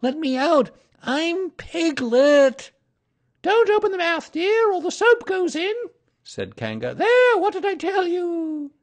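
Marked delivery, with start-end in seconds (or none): 0.0-2.7 high, loud protest, slowing; small animal's high, loud plea; crying out. A narrator's reading. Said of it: "cried Piglet". 3.4-5.7 very high, loud mother kangaroo; high-pitched motherly warning; Kanga's voice. A narrator's reading. Kanga bathing supposed child. 6.9-8.7 very high, loud mother kangaroo; high-pitched motherly told-you-so; Kanga's voice. A narrator's reading. Kanga scolds supposed child.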